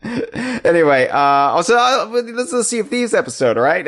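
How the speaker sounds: slurry tipsy talk, high and fast. Real, not acted. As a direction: sound like you've had a few. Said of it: "why am I talking like a drunk?".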